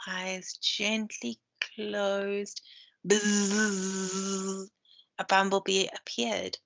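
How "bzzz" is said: buzz. A narrator's reading. Bee buzzing in story.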